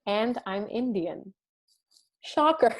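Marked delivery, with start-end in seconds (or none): 2.2-2.7 dry sarcastic quip, higher and louder. Acted. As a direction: say it like it's obvious. Mock surprise at own ethnicity.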